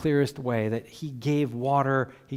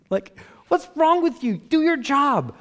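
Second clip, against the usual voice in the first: much higher, put-on exasperated boss; mock angry boss; 13 semitones higher, 4 dB louder than usual. Boss scolding a worker who came back empty-handed, mock exasperation. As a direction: play a fed-up boss snapping at staff.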